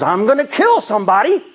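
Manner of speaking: furious but half joking; mock angry threat. A narrator's reading. Johnny leaping from the car at pranking friends, mock furious. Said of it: "Johnny jumps out of the car and says".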